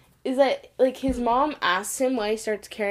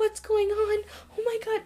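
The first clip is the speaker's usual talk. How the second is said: much higher, hammed-up fake bewilderment; mock playing dumb; 13 semitones higher than usual. Showing how Tom should play dumb, squeaky fake innocence. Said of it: "you'd be like".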